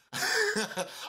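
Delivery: sudden squeaky laugh; high burst of laughter. Real, not acted. Remembering Roberto's talks, bursting into a high laugh.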